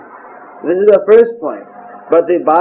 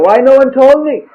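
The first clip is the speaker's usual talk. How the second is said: pitched up and louder, a dismayed outcry; shocked demand; 7 semitones higher, 4 dB louder than usual. Retold or imitated. Lord Chaitanya upset that nobody told him, shocked outcry. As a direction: demand it, stunned no one said.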